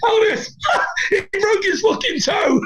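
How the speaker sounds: can barely get the words out for laughing; howling with laughter telling the story; crying with laughter. Real, not acted. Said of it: "I was crying".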